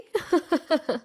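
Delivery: high, light mocking giggle; mocking laugh. A narrator's reading. Mother laughing at the duped aunt, mocking. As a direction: titter at her expense. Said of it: "she laughed mockingly and said".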